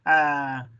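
usual speaking voice

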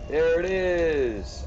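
a slow, delighted exclamation of discovery; excited find. Real, not acted. Spotting the portal for viewers, excited and drawn out. Finally finds the portal in game.

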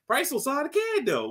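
high put-on voice; mock naive high voice; mock accented answer. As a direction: squeak it out as a clueless kid. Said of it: "He's like".

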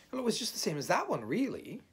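high, loud, fast reply; hasty dismissal. A narrator's reading. Rabbit answering Owl's solemn question, quick and offhand. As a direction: rattle it off, brushing the question aside.

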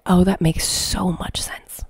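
slow breathy whisper of dawning insight; a soft slow whisper to herself; whispered realization. Retold or imitated. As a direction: breathe it out as it clicks. Light-bulb realization.

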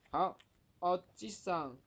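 ordinary talk, nothing marked

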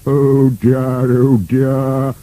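drawn-out moan, a bit high. A narrator's reading. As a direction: lament in a worried character voice. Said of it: "moaned Boney".

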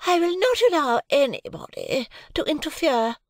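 a proud wife refusing all interference; high indignant lady voice; haughty Mrs Pocket. A narrator's reading. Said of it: "said Missus Pocket".

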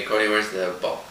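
plain reading voice

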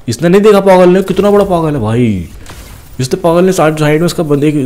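delighted taunt at a guard who didn't see; gleeful mocking. Real, not acted. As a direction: crow over the clueless guard. Laughing at a guard who missed him.

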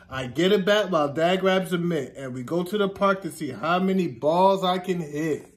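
normal talking voice

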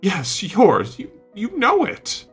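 high, loud, sputtering accusation; angry accusation. A narrator's reading. Painter blaming his friend, angry accusation. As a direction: hurl the blame back, pitch climbing high.

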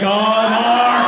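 loud, drawn-out "oh, Mark"; mock dismay. Comic reacting as Mark gets caught, mock-dismayed. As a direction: wail his name in comic dismay.

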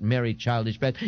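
plain reading voice

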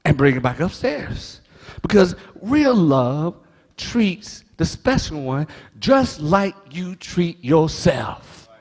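ordinary talk

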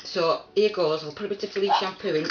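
usual speaking voice

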